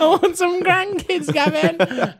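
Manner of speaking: high, slow, loud mock-mother plea; nagging mum. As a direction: wail it like a caricature mum wanting grandchildren. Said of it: "Maybe it was your mum".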